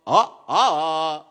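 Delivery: sung-out "ah" changing in intonation; intonation demo. Shown as a demonstration. Teacher showing listeners what swar means, intonation demo. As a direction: show the swar shifting on "ah". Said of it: "Swar means intonation of the voice".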